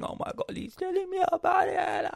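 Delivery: high, loud whiny wail; sister's retold sobbing complaint, high and loud; mock crying sister. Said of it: "then she starts crying".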